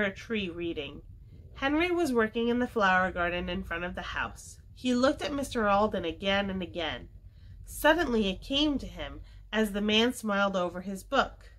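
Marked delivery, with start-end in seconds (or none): none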